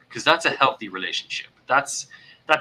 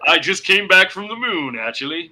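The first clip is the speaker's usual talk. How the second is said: loud, higher mock boasting; first-date braggart; 9 semitones higher, 7 dB louder than usual. Host plays a dater pretending to be impressive, mock bragging. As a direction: brag loudly, hamming it up.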